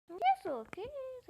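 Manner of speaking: sudden high "que isso", loud then high; startled exclamation. Real, not acted. Gamer startled by a player's message, blurting in surprise. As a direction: caught off guard, blurting it out.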